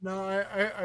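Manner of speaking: normal talking voice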